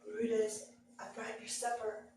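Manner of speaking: soft, crouched-down coax to a dog; quiet, careful coaxing; low coaxing. Retold or imitated. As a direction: hush it, coaxing a dog out of hiding. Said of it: "got down real low and I said".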